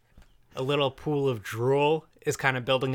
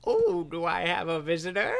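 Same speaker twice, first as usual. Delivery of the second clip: put-on higher-pitched doddering old man; 5 semitones higher than usual. Confused retirement-home resident greeting a visitor, old-man voice. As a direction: put on a raised elderly voice. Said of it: "in this retirement home".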